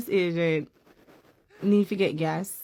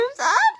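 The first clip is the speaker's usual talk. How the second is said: short laugh; 6 dB louder than usual. Real, not acted. Laughs at ice agent arrest story.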